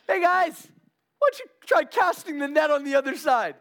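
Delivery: imitated high, loud shout from shore; calling out. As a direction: call a suggestion across the water. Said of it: "he calls out to them".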